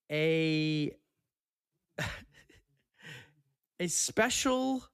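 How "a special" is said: drawn-out, high, thrilled; excited. Real, not acted. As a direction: savour the word special. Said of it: "this makes me so excited".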